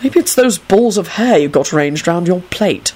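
ordinary delivery, nothing marked

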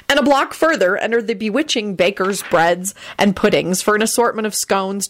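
plain reading voice

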